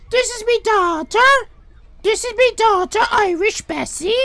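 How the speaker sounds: high introduction. Acted. Introducing his daughter.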